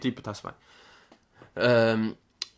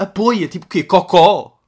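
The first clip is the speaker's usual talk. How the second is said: mock baffled shout; 5 semitones higher, 9 dB louder, slower than usual. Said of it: "E nós, tipo".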